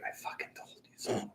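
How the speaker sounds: voiceless, very soft whisper; barely audible I-told-you-so; whispered aside. Retold or imitated. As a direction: whisper it like a look given across the table. Silent look voiced to partner.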